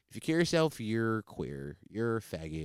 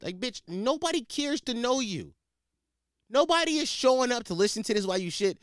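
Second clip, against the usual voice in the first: angry rant; 8 semitones higher than usual. Real, not acted. Ranting at ex-girlfriend.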